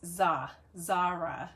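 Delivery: Za drawn out as "zah", then Zara as "ZAH-ra"; Za with open "ah". Shown as a demonstration. Host explaining her name, Za and Zara with "ah". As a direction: sound out Za slowly, then Zara. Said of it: "there's a Z A H".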